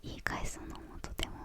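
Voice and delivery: ordinary talk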